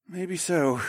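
faint croaky reply; croaked. A narrator's reading. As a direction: croak it out as if just coming round. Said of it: "he suddenly croaked".

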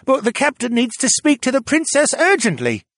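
high, strained-up crewman voice; urgent young sailor. Acted. Young sailor summoning the princess, urgent and high. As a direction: deliver the message urgently, pitched high.

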